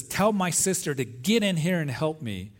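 retelling Martha's irritated complaint to Jesus; upset Martha. Retold or imitated. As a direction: mildly act out an upset sister demanding help. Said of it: "Martha gets very upset and says".

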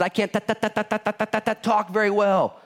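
mock stammer on ta, ta, ta before talk; stuttering Moses. Moses making excuses to God, a mock stammer. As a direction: stumble over the word talk like Moses. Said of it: "Moses had so many excuses".

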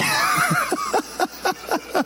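high-pitched giggle breaking out; high squeaky laugh. Real, not acted. Pastor cracking up at his own joke, high giggle.

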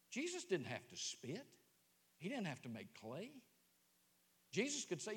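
normal talking voice

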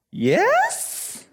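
squeaky high nervous yes; a tiny, terrified squeak of a yes; timid squeak. Retold or imitated. Missionary kid squeaks a nervous yes to the stranger. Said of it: "mustered up enough courage to be like".

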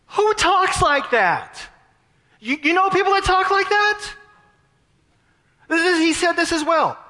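high-pitched, stunned disbelief; incredulous amazement. Real, not acted. Preacher marvelling to the congregation at a martyr's words, incredulous. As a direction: voice leaps way up, astonished.

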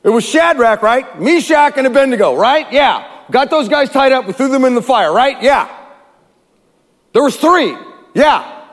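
high, puzzled king checking details; a bewildered king asking around; lightly voiced king.

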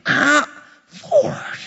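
high-pitched mock whine to God; exasperated protest. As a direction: squeal a hammed-up complaint, pitch leaping up. Acted protest when convicted by Scripture.

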